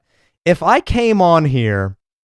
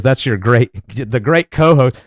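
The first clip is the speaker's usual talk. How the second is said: ordinary delivery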